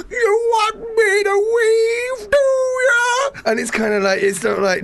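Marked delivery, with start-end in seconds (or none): 0.1-3.3 very high, slow, wailing plea. Retold or imitated. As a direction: wail it like a needy showman. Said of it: "he's, like, going".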